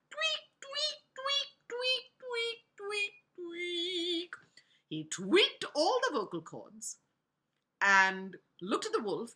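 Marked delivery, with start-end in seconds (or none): 0.1-4.3 tiny high-pitched squeaked tweaks; tweaking sound effect. A narrator's reading. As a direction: squeak each word like a string being tightened. Imitates tweaking the vocal cords.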